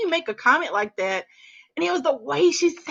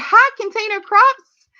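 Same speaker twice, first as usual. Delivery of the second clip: excited greeting; 10 semitones higher, 7 dB louder, slower than usual. Real, not acted. Greeting a new viewer by name.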